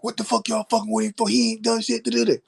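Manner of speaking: 경찰에게 대드는 친구 흉내; mock mouthing off. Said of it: "talking shit to the police".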